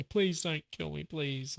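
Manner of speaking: high, slow mock pleading; grovelling Homelander. Imagining Homelander on his knees, high mock grovelling. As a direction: imitate someone begging for life, pitched high. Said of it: "see him grovel on his knees and say".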